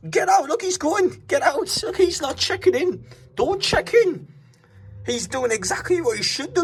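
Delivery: high, incredulous exclaiming at the noise; high-pitched disbelief. Real, not acted. Hotel guest at the noisy driver outside, high-pitched disbelief.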